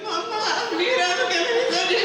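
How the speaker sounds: put-on high, pleading little-boy voice; mock a tearful kid calling mama, high-pitched; imitated tearful child. Nephew showing grandma his fake injury, mock tearful plea. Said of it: "He got tears in his eyes".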